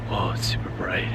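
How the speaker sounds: soft unvoiced whisper. Real, not acted. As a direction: whisper the aside. Aside, whispered.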